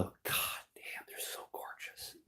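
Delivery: hushed, breathless whisper of admiration; awed whisper. Real, not acted. Streamer marvelling at beetles, in an awed whisper. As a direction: whisper it like you can't believe how beautiful they are.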